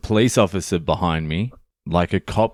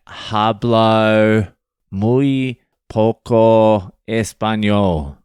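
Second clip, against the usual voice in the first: dumb tourist Spanish; 3 semitones higher, 4 dB louder, much slower than usual. Shown as a demonstration. To the traffic police, loud slow tourist Spanish. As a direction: play the earnest dumb tourist trying Spanish. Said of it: "I sound like I'm trying".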